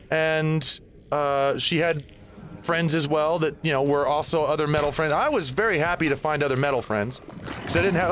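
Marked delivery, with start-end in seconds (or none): none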